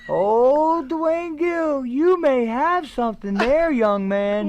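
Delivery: high, slow mock-excited old manager voice; high theatrical impression of Percy. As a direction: play the impressed veteran, drawn out. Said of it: "Percy goes".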